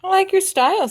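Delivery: high, lively compliment; delighted praise. Acted. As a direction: beam at a new bandmate. Lee praising Rose.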